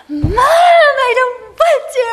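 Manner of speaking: mock-whine like a sulky fourteen-year-old; whiny teen. Daughter whining back at her mom, high and loud. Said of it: "I was like".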